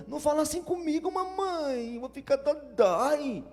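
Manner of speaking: put-on high, fast childish whine to mama; criança mimada choramingando, voz bem aguda; whiny child. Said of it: "as crianças de seis anos aprenderam".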